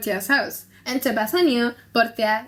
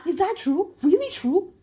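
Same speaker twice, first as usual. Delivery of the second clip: eager disbelief; 8 semitones higher than usual. Acted. Shylock presses for confirmation.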